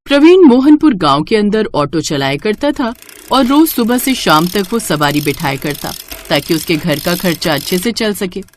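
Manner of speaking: ordinary delivery, nothing marked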